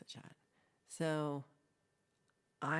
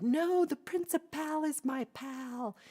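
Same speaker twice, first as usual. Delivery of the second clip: the principal is my pal; 11 semitones higher, 6 dB louder, slower than usual. Retold or imitated. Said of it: "and then I'm like".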